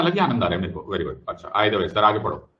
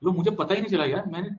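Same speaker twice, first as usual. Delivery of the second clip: normal talking voice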